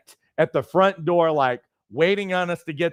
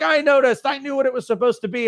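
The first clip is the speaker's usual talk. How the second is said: frustrated; 9 semitones higher, faster than usual. Retold or imitated. Husband to wife after failed party, high frustrated outburst. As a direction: blurt it out, wounded and annoyed.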